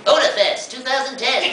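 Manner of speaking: high, nasal frog character voice; Keroro voice. Acted. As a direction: announce it like a cocky cartoon frog. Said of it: "then it became this".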